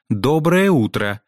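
high, slow, emphatic "Доброе утро"; loud, pointed greeting. A narrator's reading. Headmistress prompts a silent pupil to greet her, loud and pointed. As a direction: draw out the greeting pointedly and raise the pitch. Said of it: "громко произнесла".

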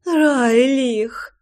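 high, imploring call of the name; pleading. A narrator's reading. Лой implores her lover by name, pleading high. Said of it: "взмолилась она".

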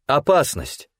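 high, ringing cry of warning; ringing shout. A narrator's reading. Anhel warns her partner of the attacker, shouting clearly. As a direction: call out the alarm in a clear, high voice. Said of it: "во весь голос звонко крикнула Анхель".

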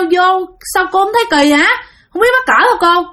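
indignant protest. Retold or imitated. Father to the woman who followed him into the bathroom, indignant. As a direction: scold the intruder in hurried disbelief. Father scolds intruder while bathing, acted.

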